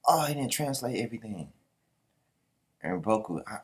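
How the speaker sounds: ordinary talk, nothing marked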